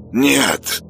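creaking like unoiled hinges; creaky hinge voice. Acted. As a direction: grate it out rusty and creaky. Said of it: "проскрипел второй".

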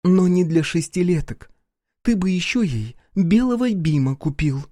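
high, cutting reproach; indignant rebuke. A narrator's reading. Mother rebukes her ex over the book, indignant. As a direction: scold him for the careless gift.

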